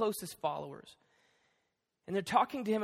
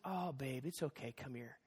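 soft, low, soothing father; hushed and gentle, trailing softer; tender comforting; 6 semitones lower, 11 dB quieter than usual. Retold or imitated. Dad consoling his crying daughter in the park, tender.

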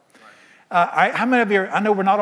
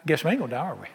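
sheepish mumble; 3 semitones lower, 6 dB quieter, faster than usual. Acted. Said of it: "standing there going".

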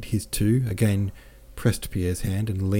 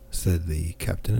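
usual speaking voice

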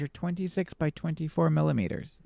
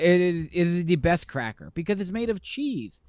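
louder, higher, delighted declaration; gleeful; 5 semitones higher, 5 dB louder than usual. Real, not acted. Cracker fan defends his favorite snack, gleefully. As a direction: gush with glee, landing on cheese. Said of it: "You say that so gleefully".

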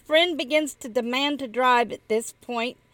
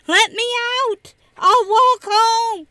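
panicked passenger screaming to get out; 9 semitones higher, 9 dB louder than usual. Acted. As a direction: yell to be let out of the car. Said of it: "with a friend screaming".

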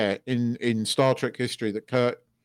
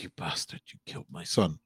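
much lower and softer, half-choked; quiet grief-struck line; 9 semitones lower, 10 dB quieter than usual. Retold or imitated. Kirk to the Klingon after his son's death, hushed and broken. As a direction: say it low, barely holding in the grief. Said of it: "quite good acting by William Shatner".

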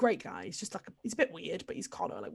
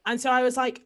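ordinary talk, nothing marked

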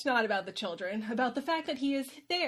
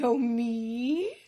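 preening dragon; 6 dB louder than usual. Retold or imitated.

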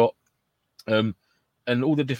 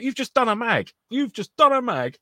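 high-pitched mock announcement, drawn out on the repeat; exasperated mock call-out; 12 semitones higher, 4 dB louder, slower than usual. Airsofter mimicking a player who emptied a magazine, exasperated. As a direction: pitch it right up, slowing as you repeat. Acted quote in a gameplay anecdote.

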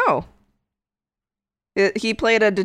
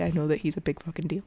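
ordinary talk, nothing marked

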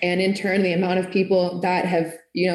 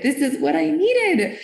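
emotional gratitude; 9 semitones higher than usual. Retold or imitated. Repeated with rising emotion.

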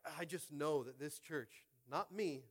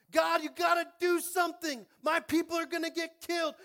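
high, loud shouted plea; desperate cry to God; 16 dB louder than usual. Acted. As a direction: beg God at the top of the voice. Mordecai crying out to God.

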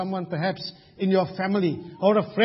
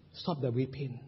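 much softer, lower plea; gentle encouraging elder; 7 semitones lower, 12 dB quieter than usual. Pretend. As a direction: hush "Stop the weeping." tenderly, almost under the breath. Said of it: "Let me be an encouraging elder".

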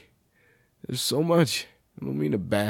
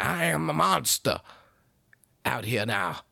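ordinary talk, nothing marked

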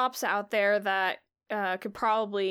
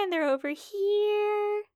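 high NPC voice; 11 semitones higher, slower than usual. Acted. NPC points repeatedly.

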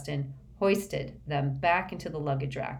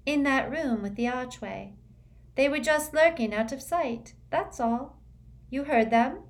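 airy, high and dreamy schoolgirl; dreamy girl voice; 8 semitones higher than usual. A narrator's reading. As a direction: float the words, lightly otherworldly.

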